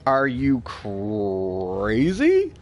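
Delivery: slow, low, drawn-out disbelief; incredulous retort. Retold or imitated. Streamer answering dismissive players in slow, low disbelief. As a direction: stretch it out like you can't believe them. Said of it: "And I was like".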